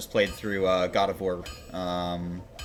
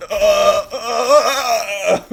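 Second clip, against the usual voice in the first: loud, high "uh" wails of fake agony; mock pained scream; 8 semitones higher, 8 dB louder than usual. Streamer mimicking a text-to-speech voice, screaming in mock pain. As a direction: imitate someone screaming in pain. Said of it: "he's, like, screaming in pain".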